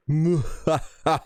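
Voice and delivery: hammed-up villain cackle, pitched high; evil laugh. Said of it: "that's my evil laugh".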